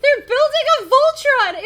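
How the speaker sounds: very high, very loud cartoon shout; alarmed cry. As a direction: shout the discovery as the panicked professor. Professor's alarmed cry in story.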